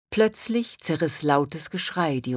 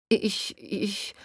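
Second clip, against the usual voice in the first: halting, higher-pitched stammer; 4 semitones higher than usual. A narrator's reading. Han Li lost for an answer, stammering. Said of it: "stotterte sie".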